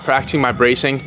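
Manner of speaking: ordinary talk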